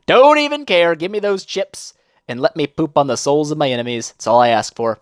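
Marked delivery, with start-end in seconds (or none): 0.1-0.9 higher, louder don't even care; higher, louder carefree. Real, not acted. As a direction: toss it off gleefully.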